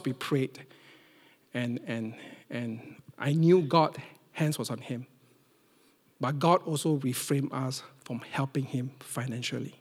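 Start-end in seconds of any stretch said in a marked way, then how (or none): none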